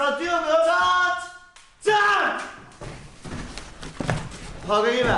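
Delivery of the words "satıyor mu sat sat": auctioneer. Acted. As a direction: chant the sale like an auctioneer. Auctioneer closing the sale.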